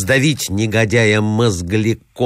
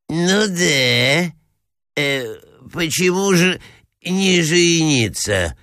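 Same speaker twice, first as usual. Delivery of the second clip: put-on higher, halting old-prince voice; befuddled assenting prince; 9 semitones higher, slower than usual. A narrator's reading. Confused prince echoes the clamouring ladies, halting assent. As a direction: agree hesitantly, dragging out the last words. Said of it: "поддакивал князь, сбитый с толку".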